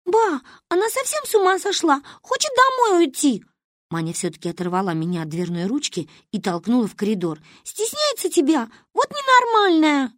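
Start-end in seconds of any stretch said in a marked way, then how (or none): 0.0-3.4 put-on very high, loud little girl; tattling child. Manya tells on friend to grandmother. 7.6-10.1 put-on very high-pitched girlish outburst; indignant child. Manya tells on friend to grandmother.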